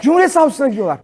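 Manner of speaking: high, loud repeat in disbelief; incredulous shout. Real, not acted. Comedian on cult members going to the prosecutor, shouted disbelief. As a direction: shout the absurd fact out to the room.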